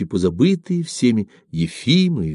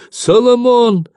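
very high, drawn-out wail; high-pitched despairing granny cry; 5 dB louder, slower than usual. A narrator's reading. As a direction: groan the name loudly like a granny. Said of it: "застонала старуха Самойлиха".

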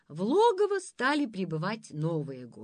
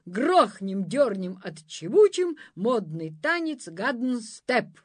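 cavalier's demand; 3 semitones higher, 6 dB louder than usual. Acted. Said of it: "объявил".

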